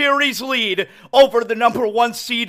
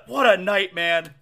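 usual speaking voice

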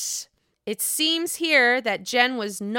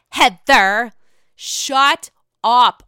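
much louder fed-up yell; exasperated outburst; 9 dB louder, much slower than usual. Real, not acted. Fed up with Whitney's plea to Heather, a loud exasperated yell. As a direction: blurt it out loud, sick of hearing it.

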